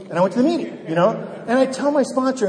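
ordinary talk

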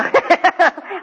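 high, giggly burst of laughter. Real, not acted. Speaker laughing at his own story, high giggle.

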